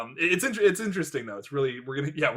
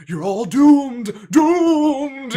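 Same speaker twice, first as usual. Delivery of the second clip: slow, high, loud doom-laden warning; crazy old prophet crying doom; slow ominous raspy drawl; 11 semitones higher, 10 dB louder, much slower than usual. Retold or imitated. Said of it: "what does he say?".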